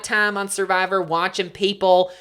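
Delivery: exasperated country rant; country accent. Pretend. Ranting to co-host about celebrities, country accent. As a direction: complain with a Southern twang. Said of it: "impressed at how good that was".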